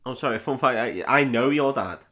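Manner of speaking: ordinary delivery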